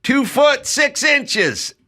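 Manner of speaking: high, slow called-out number; yelled measurement. Retold or imitated. Boss calling out to worker across the lot, high and drawn out. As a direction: call it across the vacant lot. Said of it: "he'd yell out".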